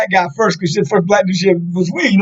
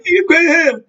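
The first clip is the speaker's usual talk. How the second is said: put-on high, puzzled old man; mock confusion; 10 semitones higher, slower than usual. Old man at the barbecue feigning confusion, high and puzzled. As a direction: play dumb, voice pitched way up. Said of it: "He looked around like".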